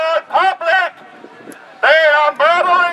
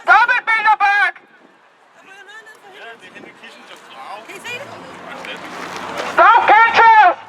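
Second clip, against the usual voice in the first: yelling; 5 semitones higher than usual. Real, not acted. Protester yelling up.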